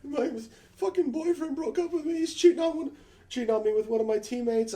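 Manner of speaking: very high, whiny imitation sobbing; hammed-up tearful falsetto; mock crying. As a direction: imitate a girl wailing through a tearful complaint. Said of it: "and she's crying".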